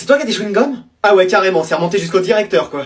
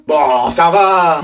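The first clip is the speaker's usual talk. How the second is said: slow weary ça va; 6 semitones lower, much slower than usual. Acted. Parent cuts off complaint.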